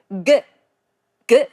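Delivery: short stopped G from the back of the tongue; teacher modeling G; velar plosive demo. Shown as a demonstration. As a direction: pop out G, g like a throat catch. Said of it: "a sound made by the root of the tongue".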